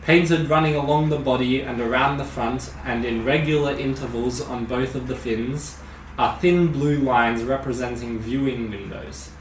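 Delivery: usual speaking voice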